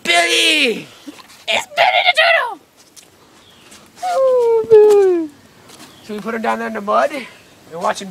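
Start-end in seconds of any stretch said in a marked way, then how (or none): 0.0-5.3 squeaky high pet voice. Real, not acted. Greeting the turtle Billy in a high baby-talk voice.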